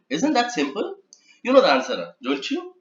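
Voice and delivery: high rising question tune. Shown as a demonstration. Trainer showing a tag question with rising pitch. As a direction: lift the ends into real questions. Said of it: "tag question".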